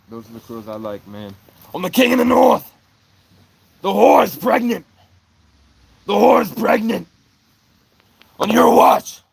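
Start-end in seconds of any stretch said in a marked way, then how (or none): 1.7-7.1 high, full-force bellow; shouted proclamation. Acted. 8.4-9.2 hard, loud accusing shout; shouted accusation. Acted.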